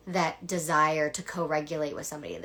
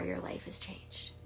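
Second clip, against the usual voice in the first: whispered; 11 dB quieter than usual. Real, not acted. Coach's hushed climax line.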